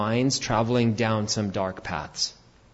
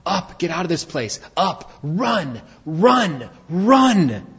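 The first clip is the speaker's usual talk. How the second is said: higher, rising cries of run; urgent cries to run; 7 semitones higher, 3 dB louder, slower than usual. Acted. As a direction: push them out the door with each run. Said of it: "what did Lot say".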